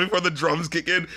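usual speaking voice

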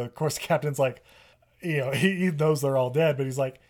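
ordinary delivery

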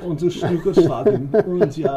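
hammed-up German gibberish, laughing; mock German accent. Friend answers in silly mock German, laughing. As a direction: babble fake German like a cartoon. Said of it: "That's German".

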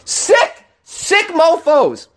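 high, drawn-out disgusted cry; disgusted outburst. Real, not acted. Host reacting to the abusers, high drawn-out disgust. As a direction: spit it out in disgust, slowly.

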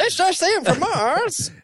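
high, slow newsreel announcer; old-timey newsreel voice. Pretend. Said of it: "that old-timey news voice".

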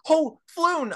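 high, startled cry; Arnold coming to. Acted. Arnold comes to and sees his friend, high startled cry. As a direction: cry out the name high, then let it fall. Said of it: "he's coming to and he's like".

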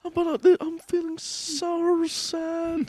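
high, slow squeaky mock moan; hammed-up Ron. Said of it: "Ron voiced while sucked".

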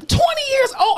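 incredulous. Real, not acted. Incredulous retort.

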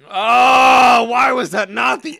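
loud, high-pitched outburst; outraged shout. Real, not acted. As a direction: cry out as if robbed of the best joke. Mock outrage over a missed title.

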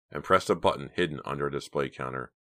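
plain reading voice

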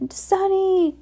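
high, drawn-out call; high-pitched narrated scream, held back in volume; screamed name. A narrator's reading. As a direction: cry out the name in alarm. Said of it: "Klaus screamed".

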